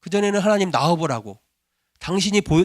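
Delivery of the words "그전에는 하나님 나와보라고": usual speaking voice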